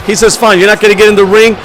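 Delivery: loud, driving ringside commentary; hyped play-by-play. Acted. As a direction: call the match like an arena announcer. Live wrestling match commentary.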